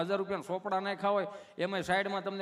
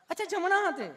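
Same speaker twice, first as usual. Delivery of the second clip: very high put-on nurse voice; sweetly asking nurse; 11 semitones higher, 3 dB louder than usual. Nurse repeating patient's answer, high mock sweetness. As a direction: echo the patient in a high coo. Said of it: "તમે કેટલું પ્રેમથી પૂછો છો".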